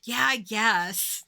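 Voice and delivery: slow, reluctant mock dispatcher; small-town operator. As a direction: drag it out like a grudging operator. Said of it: "typical small town operator".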